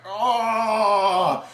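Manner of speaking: long loud imitated roar; hammed-up cry of supercharged villain; mock power-up roar. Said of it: "and he's like".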